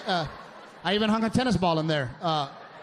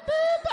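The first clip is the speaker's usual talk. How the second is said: high squeaky imitated car beep; mock warning beep. Mimicking the car's fuel warning, a high beep.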